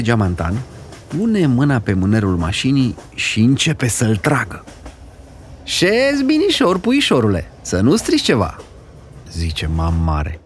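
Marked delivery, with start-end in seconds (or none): 5.6-8.6 cautioning. Acted. Grandmother warns boy.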